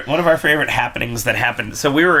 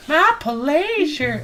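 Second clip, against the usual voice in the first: "My pleasure" slow and much higher; sweet drawn-out reply; much slower than usual. Acted. Cashier girl nailing the line for her manager, high and slow. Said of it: "Oh, I love it".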